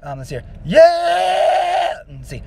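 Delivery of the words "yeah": high loud fry scream on yeah. Shown as a demonstration. Showing how the fry scream sounds, high loud yeah. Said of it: "so I do it kind of".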